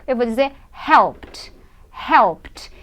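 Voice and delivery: helped as one syllable ending in a pt cluster; teacher modelling helped. Shown as a demonstration. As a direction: end helped with a t. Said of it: "a pronúncia é como se fosse T".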